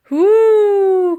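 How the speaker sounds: high, loud, long gleeful whoo; a mammoth loving the speed; joyful whoop. A narrator's reading. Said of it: "called out Woolly joyfully".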